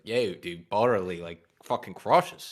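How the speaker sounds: lightly voiced Philly accent; easy Philadelphia bro accent. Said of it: "I wanted to do a Philly voice".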